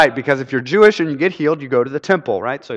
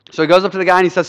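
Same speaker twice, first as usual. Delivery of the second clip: normal talking voice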